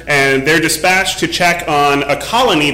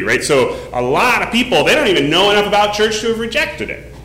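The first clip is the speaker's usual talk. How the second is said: usual speaking voice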